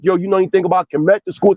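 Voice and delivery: quick question. Retold or imitated. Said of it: "be like".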